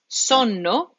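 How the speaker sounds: SONNO with a short O and held N; double N. Shown as a demonstration. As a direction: say both Ns in SONNO, "son-no". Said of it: "you said two N's".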